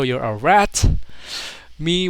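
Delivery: ordinary talk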